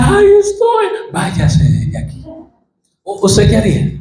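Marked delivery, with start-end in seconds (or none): 0.0-0.9 mock exasperated cry. Imagined healer snaps at mourning crowd, exasperated cry. 1.1-2.2 cold whispered brush-off; harsh dismissal. Pretend. Imagined healer coldly sends mourners away, harsh whisper. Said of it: "qué duro, qué indolente".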